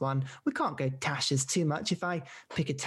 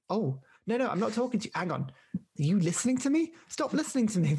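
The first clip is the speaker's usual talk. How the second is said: higher-pitched, half-panicked objection; mock alarmed protest; 6 semitones higher, faster than usual. Person to the always-listening Alexa, mock alarmed protest. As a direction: tell off an eavesdropping gadget, playfully.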